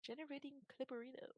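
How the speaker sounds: whispered robotic announcement. Pretend. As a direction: breathe it like a machine readout.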